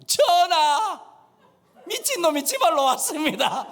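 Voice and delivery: high, loud report in Gyeongsang dialect; Gyeongsang accent. Acted. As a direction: call out to the king in broad Gyeongsang. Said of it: "경상도 말로". (왕에게 크게 외치는 경상도 사투리)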